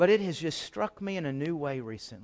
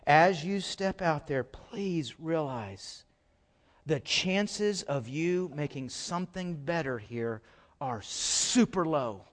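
ordinary talk

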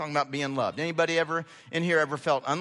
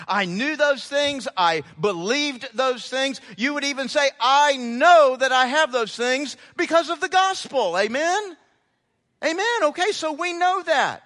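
higher, louder call-and-response preaching; fervent preaching; 8 semitones higher, 7 dB louder than usual. Acted. Pastor rousing the congregation to answer amen, fervent preaching. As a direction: drive it home to the pews.

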